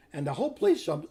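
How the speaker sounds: ordinary talk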